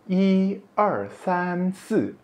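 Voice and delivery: I held high and level at the start of counting; first-tone I. Shown as a demonstration. Teacher counting in Mandarin, high level first-tone I. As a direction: sustain I high, then count er, san, si. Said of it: "kita pakai nada pertama".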